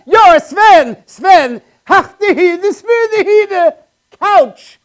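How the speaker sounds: loud, high mock Swedish yelling; high, loud comic Swedish; mock Swedish shouting. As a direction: shout fake Swedish at the names. Imitating Swedes laughing at names.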